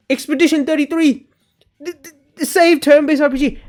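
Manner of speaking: very high, put-on fanboy voice; mock fan hype. Streamer imitating fans hyping the newer game as savior, mock hype. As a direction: parody gushing fans, stammering in excitement. Mocking the same hype again.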